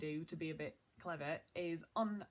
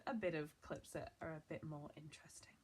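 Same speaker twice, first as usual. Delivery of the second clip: whispered aside; 4 semitones lower, 8 dB quieter than usual. Acted. Teasing aside about clips.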